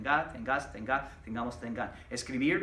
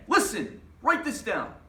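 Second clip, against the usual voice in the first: higher, louder imperative; sharp command; 6 semitones higher, 5 dB louder than usual. Shown as a demonstration. Teacher demonstrating the imperative to class, as a sharp command. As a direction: give it as a firm order. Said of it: "which is the command".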